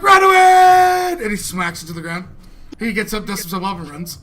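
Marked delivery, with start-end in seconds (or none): 0.0-1.2 high, drawn-out cry of retreat; fleeing yell. Acted. Game master voices fleeing bard.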